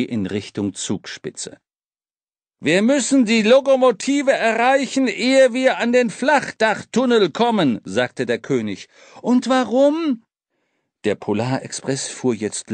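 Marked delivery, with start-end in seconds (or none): 2.6-7.8 urgent. Acted. Urgent warning on the train. 9.2-10.2 put-on boy asking. Boy asks on shoulders.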